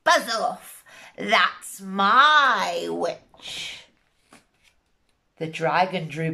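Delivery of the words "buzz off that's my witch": very slow drawn-out monster growl; beast voice. Acted. Swamp beast warning the dragon, very slow growl. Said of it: "it said to the dragon".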